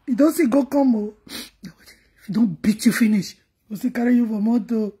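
ordinary talk, nothing marked

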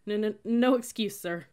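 loud, slow stammered reply; stuttering. A narrator's reading. Rattled recruits to glaring cadet, stuttering. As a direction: trip on the first sound, then answer. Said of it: "somehow we stuttered".

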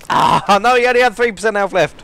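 loud, high cry of frustration; frustrated outburst. Real, not acted. Player lamenting an enemy left on three percent health, frustrated yell. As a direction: yell out in exasperation at a near miss. Just missed a kill in game.

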